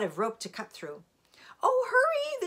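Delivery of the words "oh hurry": very high, loud urgent plea; frantic pleading. A narrator's reading. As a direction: plead like a panicked dragon. Dragon pleads while rope is cut.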